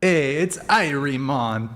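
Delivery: put-on slow, lightly done Jamaican accent. Streamer thanking subs in a mock Jamaican accent. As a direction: drawl it like a Jamaican. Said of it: "like I'm Jamaican".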